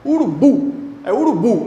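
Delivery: "urubu" with a high, strong final BU; stress on last syllable. Shown as a demonstration. Teacher showing the strong syllable, "urubu" stressed on BU. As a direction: punch the BU at the end of "urubu". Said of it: "O forte é o bu".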